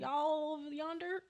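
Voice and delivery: high, slow, badly faked country accent; country accent attempt. Shown as a demonstration. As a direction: try a country drawl and miss. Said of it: "I can't do a country accent".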